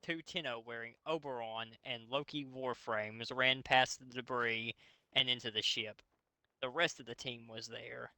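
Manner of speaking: normal talking voice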